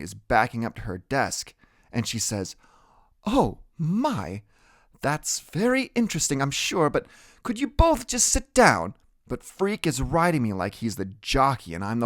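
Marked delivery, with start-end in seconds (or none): 3.2-8.9 a hint of a flustered teacher. A narrator's reading.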